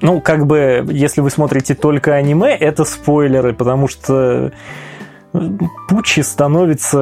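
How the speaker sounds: normal talking voice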